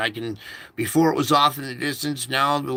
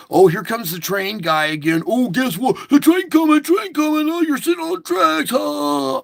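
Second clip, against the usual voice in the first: much higher and louder taunting singsong; mocking driver; 12 semitones higher, 6 dB louder than usual. Driver taunting the train guy, high and shrill mockery. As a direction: taunt him like a schoolyard bully. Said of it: "mocking me".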